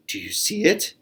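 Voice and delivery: high, eager whisper; excited whisper. Acted. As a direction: whisper it to lure him on. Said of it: "cried Borreguita".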